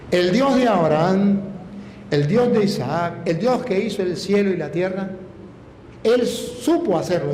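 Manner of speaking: slow, solemn praise; preacher glorifying God to the congregation, slow and solemn; preaching. Acted. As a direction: lift each name of God slowly.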